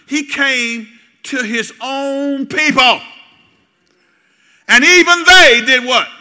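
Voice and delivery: high, loud, slow preacher's cry; preaching at full force. Acted. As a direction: lift the congregation with a slow climbing call.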